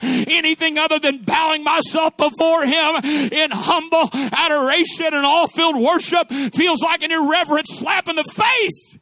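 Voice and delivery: driving, rhythmic sermon declamation; impassioned preaching. Real, not acted. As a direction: proclaim in rolling phrases, building in force.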